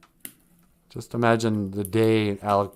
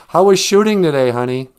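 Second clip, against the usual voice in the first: lightly voiced wife, loud and lifted; mock wife; 5 semitones higher, 8 dB louder than usual. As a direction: call out a spouse's greeting, pitch raised at the start. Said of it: "Or she was like".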